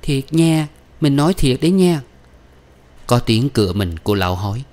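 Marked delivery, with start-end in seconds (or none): 0.0-2.0 high-pitched, wheedling imitation; high, pouty teasing; coquettish mimicry. A narrator's reading. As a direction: playfully mimic your lover's voice. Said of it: "nũng nịu và bắt chước cái giọng của gã".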